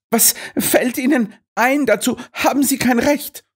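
very high whiny wail; wailing complaint. A narrator's reading. Miss Claxton wails at Holmes over the parcel, very high. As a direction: wail in protest. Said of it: "jammerte sie".

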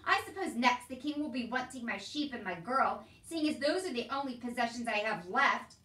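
loud, sarcastic tirade; indignant shouting. A narrator's reading. Uncle protesting to the soldier taking his things, indignant. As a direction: complain loudly and bitterly. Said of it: "he shouted".

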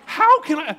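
high, loud exasperated outburst; mock exasperation. Driver stuck in the roundabout, exasperated. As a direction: cry out in comic despair. Acts out himself stuck driving.

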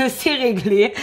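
bursting into a laugh. Real, not acted. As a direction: giggle through the words. Laughing as she clears letters.

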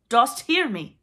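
high, very loud challenge. A narrator's reading. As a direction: call out to an unseen spirit. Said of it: "Hook raised his voice".

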